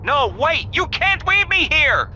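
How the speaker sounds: high, loud desperate plea; panicked shout. Acted. Recruit yells at the boss cutting transmission, panicked and loud. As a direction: yell after the departing boss.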